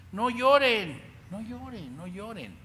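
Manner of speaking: Jesus commanding. Retold or imitated. Jesus telling the mourners not to weep, loud then fading. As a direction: cry out then ease off gently.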